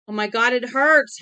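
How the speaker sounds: loud anguished cry; screaming in pain. Acted. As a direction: cry out in agony. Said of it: "Hector Drogan screamed from above them".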